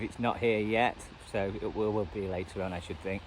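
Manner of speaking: ordinary talk, nothing marked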